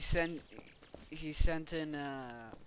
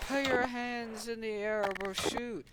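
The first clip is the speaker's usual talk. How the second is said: higher-pitched mock command; pretend police warning; 7 semitones higher than usual. Police outside warning the gang, mock command. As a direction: call out a play cops' threat.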